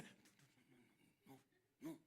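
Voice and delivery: breathless whisper of a fretting parent; whispered worry. Retold or imitated. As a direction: hiss it like a nervous father. Anxious parent stopping the child.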